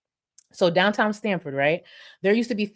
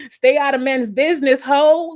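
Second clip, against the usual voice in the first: mock scolding yell; 7 semitones higher, 6 dB louder than usual. Retold scolding of the woman.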